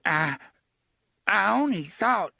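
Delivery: high, halting start; stammering fear. Acted. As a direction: stammer it out scared. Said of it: "Hoots began".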